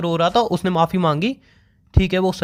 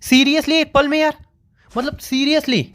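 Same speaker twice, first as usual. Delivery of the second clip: disbelief; 9 semitones higher, slower than usual. Real, not acted. Boyfriend hears she is leaving.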